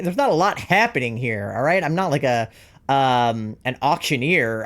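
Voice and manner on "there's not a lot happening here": usual speaking voice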